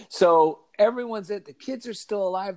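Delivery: normal talking voice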